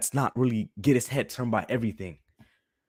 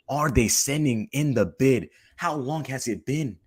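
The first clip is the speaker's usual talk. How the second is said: ordinary delivery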